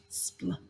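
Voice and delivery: "Spl" breathed softly, unvoiced; whispered sound. Shown as a demonstration. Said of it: "what do we hear next".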